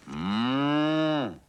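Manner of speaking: high, very loud drawn-out moo; cow moo. Acted. Cow eating buttercups, a loud long moo. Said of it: "thought the cow".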